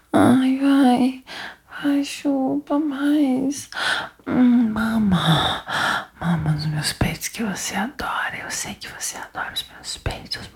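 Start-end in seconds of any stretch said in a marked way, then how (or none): 6.2-10.5 low, soft unvoiced whisper; intimate whisper. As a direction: whisper it right into the ear.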